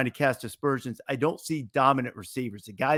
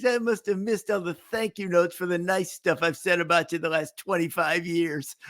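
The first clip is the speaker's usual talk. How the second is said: lightly voiced announcer quote; a hint of the Raftery whine; Bill Raftery impression; 5 semitones higher than usual. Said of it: "He'd say".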